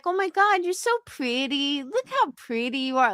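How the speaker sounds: put-on high, cutesy baby-talk gushing; put-on sugary high voice, poking fun; mocking baby voice. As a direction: gush in a mock baby voice. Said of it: "sometimes I'll make fun of her".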